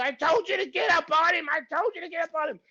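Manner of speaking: high-pitched imitated coach tirade; mock exasperated coach yelling; scolding coach. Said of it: "I hear Phil say".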